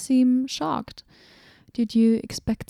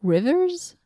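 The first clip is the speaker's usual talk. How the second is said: puzzled; 6 semitones higher than usual. Acted. Puzzling over the inscription.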